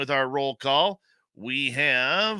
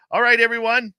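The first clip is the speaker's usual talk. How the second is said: high, loud call to the crowd; rally everyone for the start of the show; 11 semitones higher, 8 dB louder than usual. Real, not acted.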